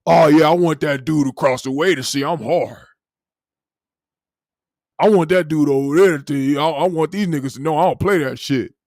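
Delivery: hammed-up tough guy boasting; mock tough guy. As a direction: puff up like a wannabe hard man. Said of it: "so other dudes can see them".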